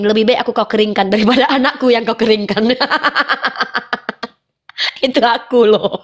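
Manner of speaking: laughing at own joke. Real, not acted. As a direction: break into laughter. (sambil tertawa)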